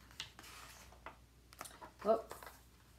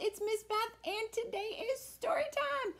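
put-on high, bright kids' host greeting; reading to children; 12 semitones higher than usual. Miss Beth greeting children, high storytime voice. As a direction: welcome the little ones cheerfully.

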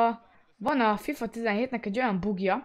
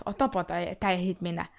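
ordinary talk

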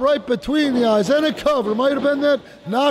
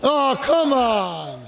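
slow, drawn-out "come on"; disappointed groan; much slower than usual. Real, not acted. Commentator disgusted by the cheap shot, slow groan. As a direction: let the letdown drag out.

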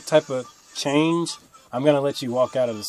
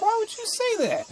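annoyed; 6 dB quieter than usual. Retold or imitated. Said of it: "it made me mad".

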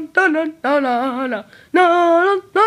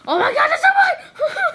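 excited laughing shout; 13 semitones higher than usual. Real, not acted. Overwhelmed by filter clones.

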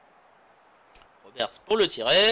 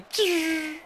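high shot sound; 11 semitones higher than usual. Retold or imitated. Imitating ball flying.

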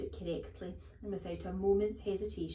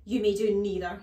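loud, sharp refusal; snapped; 11 dB louder than usual. A narrator's reading. Headmistress refusing a pupil's request, snapping. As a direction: snap it out like a stern headmistress. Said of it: "snapped the Trunchbull".

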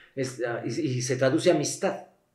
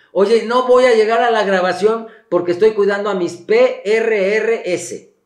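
spelling out the consonants; 6 semitones higher, 6 dB louder than usual. Shown as a demonstration. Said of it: "¿Qué dice ahí?".

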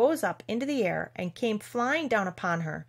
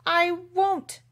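high, loud, stubborn refusal; acted flat-out refusal; defiant; 10 semitones higher, 7 dB louder than usual. A narrator's reading. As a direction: stand up to the queen, voice raised. Alice defies the angry queen.

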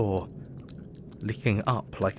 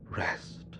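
each rest softer until it's just breath; fading to a whisper; 3 semitones lower, 9 dB quieter, much slower than usual. A narrator's reading. Exhausted doctor longing for sleep, fading whisper. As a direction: let the words trail off into a whisper.

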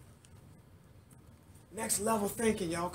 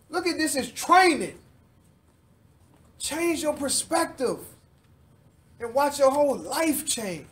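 louder, building sermon; fired-up preaching, pushing louder; 5 semitones higher, 4 dB louder than usual. Acted. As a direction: preach it up to the congregation. Preaching at rising force.

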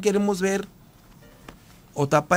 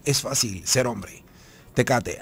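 lower, softer, slow ad-voice tagline; announcer slogan; 5 semitones lower, 4 dB quieter than usual. Retold or imitated. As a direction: deliver it like the ad's closing voiceover. Cita el eslogan del anuncio. (eslogan de anuncio, grave, suave y lento)

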